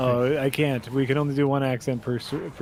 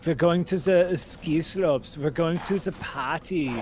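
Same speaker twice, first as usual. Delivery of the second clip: put-on Euro trash accent; 3 semitones higher than usual. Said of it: "That's the accent".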